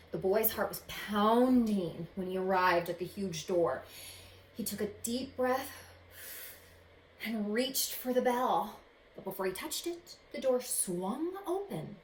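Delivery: expressive read-aloud storytelling; story narration. A narrator's reading. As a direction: read it like a picture book to kids. Reads picture book aloud.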